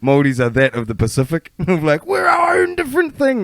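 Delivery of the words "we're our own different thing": squealed falsetto protest; mock indignant insistence. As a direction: imitate someone protesting in a very high voice. Mocking Māori insistence on difference.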